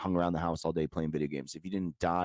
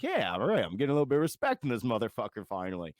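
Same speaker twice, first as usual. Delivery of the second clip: higher, quick mock-satisfied dad voice; imitated pleased dad; 5 semitones higher, faster than usual. Dad privately reacting to being called sir, imitated pleased relief. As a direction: play a dad relishing long-overdue respect.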